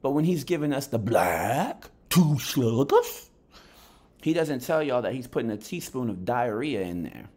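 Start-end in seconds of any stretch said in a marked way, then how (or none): 1.0-3.3 slow, louder pundit catchphrase voice; imitating Showbiz. Mocking the boxing YouTuber's catchphrase for viewers, slow. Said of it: "that's how he talks".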